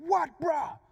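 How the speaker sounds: high, loud confrontational yell; angry Pidgin challenge. Retold or imitated. Driver yells out his window while passing, angry challenge. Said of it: "I'm passing him".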